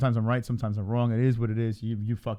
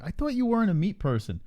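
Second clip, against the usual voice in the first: mock surprised question; 8 semitones higher than usual. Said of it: "I'm like".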